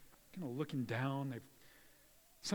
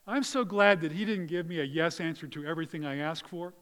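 ordinary delivery

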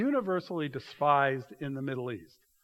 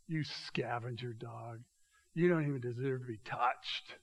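contemptuous insult; 4 semitones lower, 6 dB quieter than usual. Retold or imitated.

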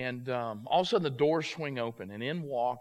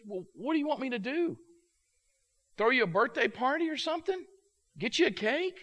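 high, scornful sarcastic questions; sarcastic needling; 10 semitones higher, 4 dB louder than usual. Retold or imitated. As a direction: needle her with mock offers, pitched high. Friend mocks the birthday wish.

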